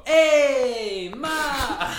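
high, loud, laughing "Hey, Ma!" in a mock New York Italian voice; Italian-American greeting. Son greeting his mom, hammed-up New York Italian shout. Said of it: "I'll go".

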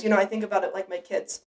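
ordinary talk, nothing marked

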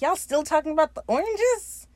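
exasperated mock voice. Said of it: "He's like".

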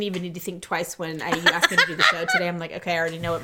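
rushed laugh. Real, not acted. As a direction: speed through it with a laugh. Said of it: "laughs while telling".

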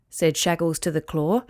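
usual speaking voice

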